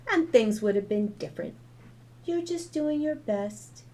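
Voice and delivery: put-on high child's voice, gentle and grown-up; a little girl comforting her mom wisely. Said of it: "made anyone who heard her think she was a grown-up".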